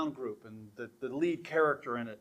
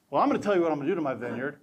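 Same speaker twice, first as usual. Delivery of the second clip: rushed owner; much faster than usual. Acted. Vineyard owner voiced.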